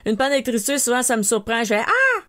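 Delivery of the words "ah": short high scream as the lights go out; retold scream when the power cuts; startled scream. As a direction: shriek in sudden fright, high and loud. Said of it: "je crie".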